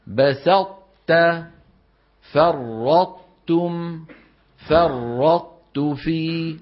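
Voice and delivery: بسطت, فرطتم drawn out very slowly; slow tajweed demo. Shown as a demonstration. Teacher slowly modelling بسطت and فرطتم. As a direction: stretch بسطت and فرطتم, ط merging into ت. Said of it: "انظروا، لا تحكوا أنتم".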